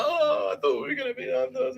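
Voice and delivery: hammed-up high girlfriend voice. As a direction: play the disappointed girlfriend, high.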